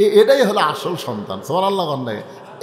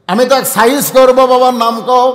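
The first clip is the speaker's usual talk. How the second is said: high, loud threat; boy shouting; 6 semitones higher, 9 dB louder than usual. Acted. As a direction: shout like a boy ready to fight. Boy threatens to sort them out. (রাগী চিৎকার)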